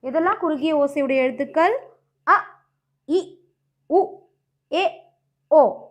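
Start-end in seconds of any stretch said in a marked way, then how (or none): 2.3-5.9 அ, இ, உ, ஏ, ஓ each cut short; brief separate vowels with gaps between. Shown as a demonstration. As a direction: recite அ, இ, உ, ஏ, ஓ as quick short sounds. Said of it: "குறுகிய ஓசை உடைய எழுத்துக்கள்".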